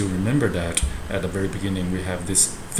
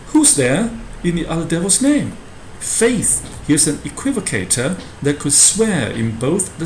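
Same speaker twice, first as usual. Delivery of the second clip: theatrical porter voice; 4 semitones higher than usual. Acted. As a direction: ham it up as the porter mocking a visitor. Teacher acts porter's speech.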